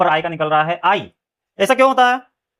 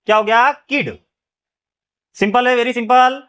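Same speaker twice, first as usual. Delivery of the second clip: usual speaking voice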